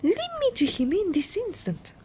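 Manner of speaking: high, imperious order; mother ordering Wonka to take her to her son; commanding mother. Acted. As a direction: demand it at once.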